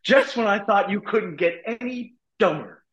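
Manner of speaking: slow and high, like quoting a dumb comedy; movie quote voice. Retold or imitated.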